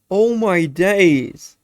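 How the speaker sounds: ordinary talk, nothing marked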